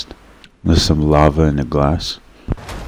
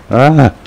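short, high, loud yelp; sudden high cry of alarm; startled yelp; 10 semitones higher, 6 dB louder than usual. Real, not acted. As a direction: yelp in surprise. Startled by pursuer in game.

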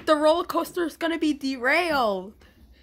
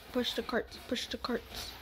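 whisper; 3 semitones lower, 12 dB quieter than usual. Real, not acted. Quiet urging during play.

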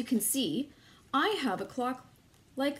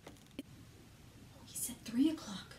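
softly whispered praise; 10 dB quieter, slower than usual. Acted. Teacher hushes the class while sneaking up, whispering. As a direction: keep it hushed so the wolf won't hear.

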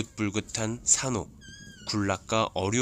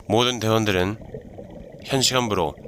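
squad orders. Pretend. Commander orders safety stop.

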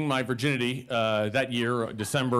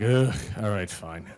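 reluctant sigh, dropping low; unenthused, resigned acceptance; grudging resignation; 5 semitones lower than usual. Acted. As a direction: give in with a disgusted ugh, trailing lower. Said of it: "it's like".

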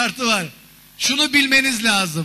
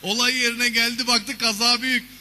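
normal talking voice

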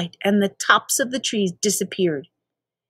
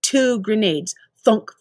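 usual speaking voice

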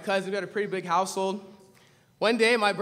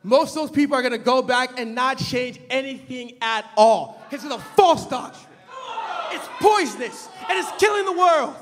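fiery preaching; 8 semitones higher, 4 dB louder than usual. Acted. Preacher thunders at his congregation, then drops to a hush. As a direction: preach it hard from the pulpit, then let it drop. Preaching resumes after the story.

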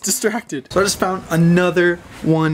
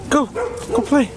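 excited calling; 9 semitones higher, slower than usual. Real, not acted. Urging dog to play.

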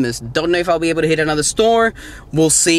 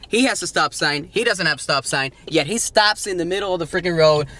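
normal talking voice

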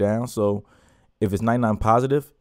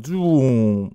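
louder, higher vocal zoom of a slowing record; slowdown sound effect; 4 semitones higher, 5 dB louder than usual. Retold or imitated. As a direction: mimic a record winding down. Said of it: "make it do a slow down".

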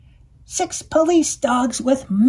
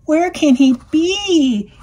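high, loud, slow howl; 6 semitones higher, 5 dB louder, slower than usual. A narrator's reading. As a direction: howl it out in worry. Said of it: "howled the cat".

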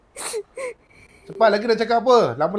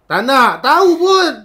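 mocking retort; 7 semitones higher, 10 dB louder, slower than usual.

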